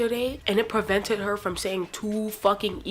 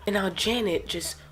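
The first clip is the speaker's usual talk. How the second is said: ordinary delivery, nothing marked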